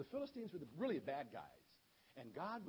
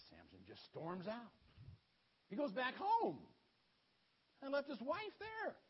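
usual speaking voice

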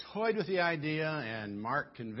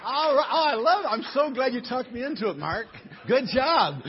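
much higher, much louder delighted cheer; excited praise; 10 semitones higher, 10 dB louder than usual. Real, not acted. Pastor thrilled the round worked, excited. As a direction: burst out with delight.

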